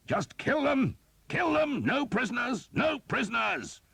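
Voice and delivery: very high, loud merciless command; shouted order. A narrator's reading. The Earl ordering his men to spare no one, a high loud roar. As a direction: roar it with no mercy. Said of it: "shouted in French".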